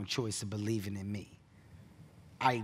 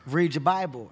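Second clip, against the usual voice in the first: a louder, insistent mom order; mom's firm order; 3 semitones higher, 8 dB louder than usual. Retold or imitated. Imitating mom insisting he read his Bible, louder. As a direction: plant your feet and repeat the order. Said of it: "and she's like".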